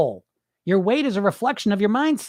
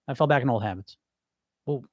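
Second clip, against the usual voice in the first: ordinary talk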